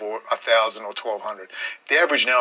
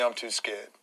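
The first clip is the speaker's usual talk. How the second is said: mock whine; 4 semitones lower, 9 dB quieter than usual. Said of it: "the person that whines to me".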